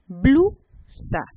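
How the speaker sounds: Blusa split as BLU, pause, SA; syllable by syllable. Shown as a demonstration. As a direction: hold BLU apart from SA in Blusa. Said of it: "Estas, aquí tenemos consonante".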